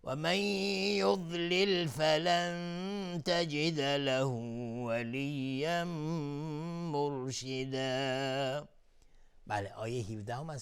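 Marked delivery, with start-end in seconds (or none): 0.0-8.7 slow, higher, melodic tajweed chant; Quran recitation. Acted. Teacher recites the verse for students, slow melodic chant. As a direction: draw out each verse like a reciter.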